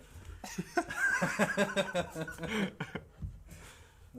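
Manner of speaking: long hearty laugh. Real, not acted. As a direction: laugh out loud. Big laugh after joke.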